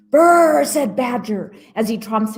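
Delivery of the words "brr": shivering brr. Acted. Badger comes in from cold.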